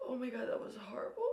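unvoiced, fast shaken whisper. Real, not acted. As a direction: whisper it, still rattled. Shaken whisper after fright.

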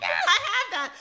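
laughing while admitting it. Real, not acted. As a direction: laugh through the words. Laughing at shared purchase.

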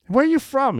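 very high-pitched imitated Boston question; bad Boston accent. One soldier asks another his hometown, bad Boston accent. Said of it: "my accent sucks".

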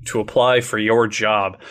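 ordinary delivery

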